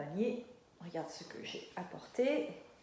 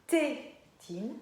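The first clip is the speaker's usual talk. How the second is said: TÉTINE split, strong TÉ, pause, TINE rising; first syllable stressed; 2 semitones higher than usual. Shown as a demonstration. Teacher comparing two word beginnings, first syllable stressed. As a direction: lean on TÉ in TÉTINE and detach TINE. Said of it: "C'est la même syllabe".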